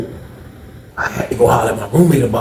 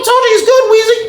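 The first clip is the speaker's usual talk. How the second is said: high quoted voice; 3 dB louder than usual. Retold or imitated. Cellmate vouching for narrator.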